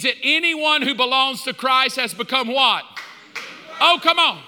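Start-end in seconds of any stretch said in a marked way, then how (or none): 3.8-4.4 high, loud goading shout; rousing call. Real, not acted. Preacher urging congregation to answer, rousing shout. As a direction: egg the crowd on loudly.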